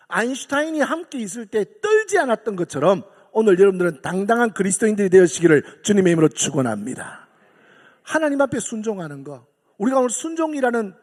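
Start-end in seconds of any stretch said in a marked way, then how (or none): 0.1-7.3 loud, ringing sermon cadence; full-force preaching. Acted. Preacher rallies congregation, loud and fervent. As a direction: proclaim it to the whole hall.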